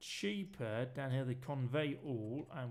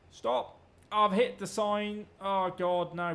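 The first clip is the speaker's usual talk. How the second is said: real alarmed yell, much louder; startled shout; 4 semitones higher, 8 dB louder, slower than usual. Real, not acted. Gamer startled after crashing into a sign, loud alarmed yell. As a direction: yelp as the crash happens.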